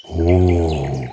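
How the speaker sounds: long, drawn-out bear's oh; amazed. A narrator's reading. Bear discovers the festive table, amazed. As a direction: marvel in a character voice. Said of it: "staunte Umberto".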